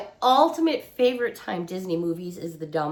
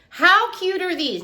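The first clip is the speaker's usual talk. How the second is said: gushing; 10 semitones higher, 5 dB louder than usual. Real, not acted. Gushing over puppy towels.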